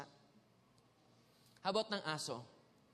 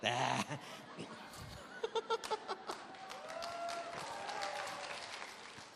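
laugh; 6 dB quieter than usual. Real, not acted. Laughing at his nickname joke.

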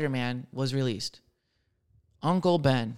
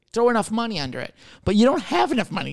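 ordinary talk, nothing marked

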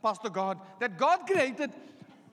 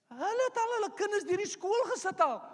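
ordinary delivery

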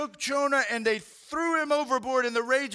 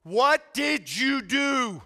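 louder, slow accusing questions; alarmed sailors; 4 dB louder, slower than usual. Retold or imitated. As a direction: demand answers, scared and angry. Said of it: "They're like".

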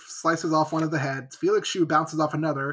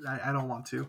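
quiet mock despair; 4 semitones lower, 9 dB quieter than usual. Real, not acted. Regretful joke aside.

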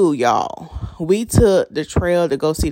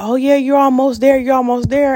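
cheery hiker encouragement; 7 semitones higher than usual. Retold or imitated.

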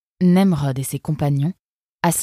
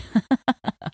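high laugh; 9 semitones higher than usual. Acted. Eunice laughs at Nemrod.

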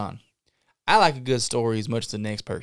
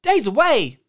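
alarmed echo; 11 semitones higher, 5 dB louder than usual. Acted. Echoing in alarm.

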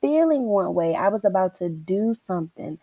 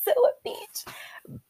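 unvoiced teasing aside; playful whispered tease; 7 dB quieter than usual. Real, not acted. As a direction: whisper it like a secret. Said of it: "whispered aside".

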